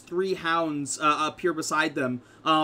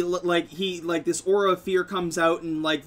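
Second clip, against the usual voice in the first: ordinary talk, nothing marked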